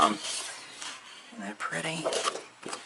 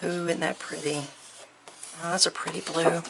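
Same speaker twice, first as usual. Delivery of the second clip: normal talking voice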